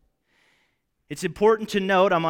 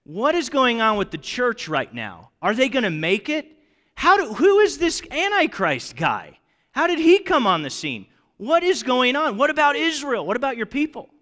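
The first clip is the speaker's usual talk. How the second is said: higher, urgent rush of questions; overwhelmed questions; 6 semitones higher than usual. Retold or imitated. John overwhelmed by the vision, higher and urgent. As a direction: pile up the questions in disbelief. Imagined John's flood of questions.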